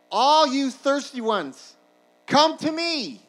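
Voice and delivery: high, slow, booming call; shouting out. Acted. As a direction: cry out to a whole festival crowd. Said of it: "he shouts out to them".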